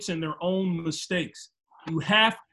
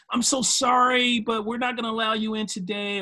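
insincere apology pitched up, just to be nice; mock polite staff turning a customer away; fake-nice customer service; 5 semitones higher than usual. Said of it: "They're just saying that just to be nice".

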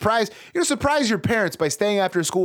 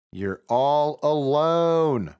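slow ominous; much slower than usual. A narrator's reading. Spooky narration line.

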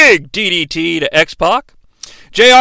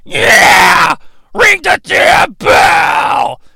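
rough, drawn-out tough guy shout; loud, hammed-up tough guy bellow; 8 semitones higher, 3 dB louder, much slower than usual. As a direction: yell it like a wrestler putting on a tough front. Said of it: "in his tough guy voice".

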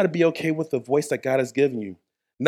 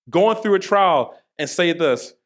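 ordinary delivery

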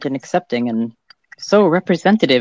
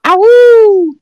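high wolf howl; 8 dB louder than usual. Acted. Co-host's greeting howl.

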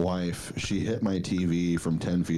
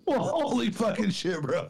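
laughing. Real, not acted. Laughing at the crude remark.

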